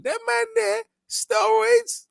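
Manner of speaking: squeaky high-pitched cry of disbelief; high excited exclamation. Real, not acted. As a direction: shriek it out in mock shock. Excited steroid jibe.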